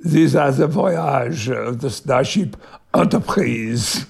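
slow, deliberate French accent; hammy, drawn-out French-accented English. Shown as a demonstration. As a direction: do Picard's famous intro in a French accent. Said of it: "I think I know what I did".

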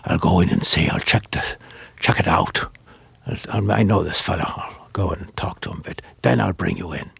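low, half-whispered confidential aside; hushed aside. Retold or imitated. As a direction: keep it down, partly whispered. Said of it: "Jerry, who would be saying".

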